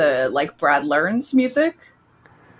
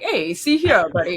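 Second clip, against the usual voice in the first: put-on Mid-Atlantic accent. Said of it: "nineteen forties, like, Atlantic accent".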